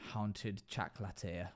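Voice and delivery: Haunted Chaclateer slowly, in a put-on French accent; mock French accent. Shown as a demonstration. Streamer mispronounces the game title, in a French accent. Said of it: "when I say it like that, it sounds like I'm saying it with, like, some kind of accent".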